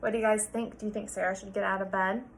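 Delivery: usual speaking voice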